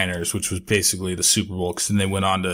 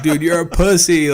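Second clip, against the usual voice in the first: taunting; 7 semitones higher, 5 dB louder than usual. Retold or imitated. Taunting the HOA president.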